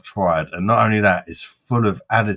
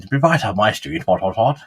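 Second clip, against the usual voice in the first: posh voice. Pretend. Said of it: "Sounds rather posh".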